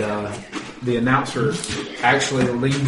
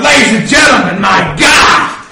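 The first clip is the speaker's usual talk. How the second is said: loud, raised wrestling announcer shout; ring announcer; 3 semitones higher, 5 dB louder than usual. Acted. Game master as announcer to the wrestling crowd, excited shout. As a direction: call it like a stunned ringside announcer. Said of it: "the announcer is in the ring going".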